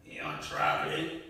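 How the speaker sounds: a ghostly, breathy monster question; creepy head. Acted. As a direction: lean in, menacing.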